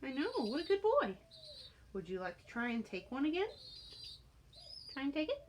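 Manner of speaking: high, bright encouragement to a bird; cheering pet talk. Real, not acted. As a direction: coax him on like a cheerleader. Encouraging bird to take treat.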